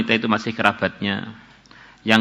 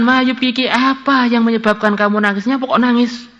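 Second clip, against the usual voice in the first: Kifl's voice; 11 semitones higher than usual. Acted. Kifl asks the trembling woman why she weeps, high. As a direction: ask her why she cries, baffled.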